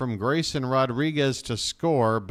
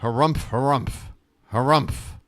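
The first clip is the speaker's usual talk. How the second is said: mock-indignant harumphs, louder, hammed up; 3 dB louder than usual. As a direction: play the huffy old grump. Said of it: "I'm ranting".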